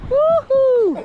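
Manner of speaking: high, drawn-out woohoo; excited whoop. Real, not acted. Fisherman cheering the haul, excited whoop.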